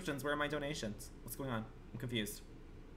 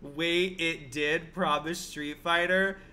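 usual speaking voice

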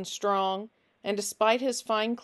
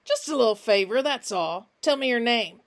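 louder, falsely friendly coaxing; fake-friendly Finch; 3 semitones higher, 6 dB louder than usual. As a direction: paint on a broad smile and coax.

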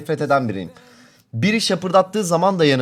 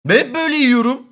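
indignant; 8 semitones higher than usual. Real, not acted. Protesting he always eats like this.

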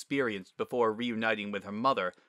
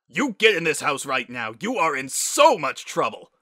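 loud, higher scolding; angry father yelling; 4 semitones higher, 9 dB louder than usual. Acted. Father ordering daughter into the house, yelling. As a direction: bellow the order furiously.

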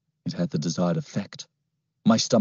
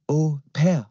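put-on French accent; 7 semitones higher than usual. Girl's words echoing in his head, French accent. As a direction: say it like the French girl.

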